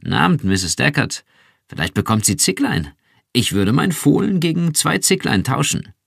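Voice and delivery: lauter, höher, über die Distanz gerufen; laut rufend. Acted. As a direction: freundlicher Nachbar ruft herüber. Nachbar Bill ruft weiter. (Nachbar ruft Frau Deckard laut zu)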